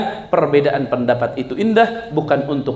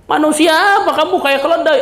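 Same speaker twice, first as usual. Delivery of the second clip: angry retort; 10 semitones higher, faster than usual. Acted. Man shouts back in the quarrel.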